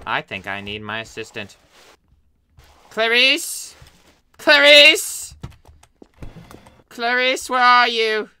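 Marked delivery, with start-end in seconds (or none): 2.9-8.3 loud, high call rising on Clarice. Acted. Doctor calls for his assistant Clarice, loud and high. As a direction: shout for her across the house.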